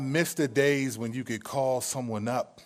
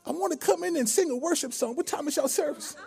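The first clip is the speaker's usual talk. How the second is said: put-on old church lady voice; 12 semitones higher than usual. Prank caller asking restaurant about church, old lady voice. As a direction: ask sweetly like an elderly churchgoer.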